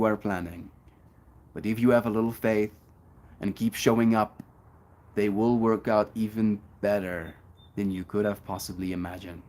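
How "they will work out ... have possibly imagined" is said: ordinary delivery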